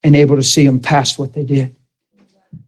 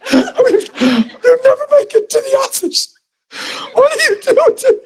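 much higher, strained and tearful; choked crying. Real, not acted. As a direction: plead through sobs, barely able to speak. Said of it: "I was choking to even say words".

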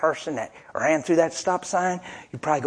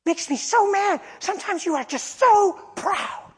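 much higher, shrill-pitched scolding voice; coworker yelling. Retold or imitated. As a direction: lay into him like an annoyed coworker. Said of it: "she just laid into me".

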